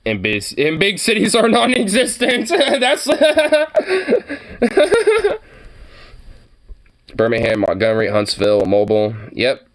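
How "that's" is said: high delighted laughter; bursting laugh. Real, not acted. Reacting to the video, breaks into high laughter.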